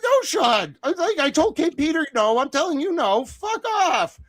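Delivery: very high, squeaky exasperated protest; hammed-up squealing brush-off; mock refusal. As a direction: refuse him in a silly high-pitched voice. Acts out refusing recruitment in high voice.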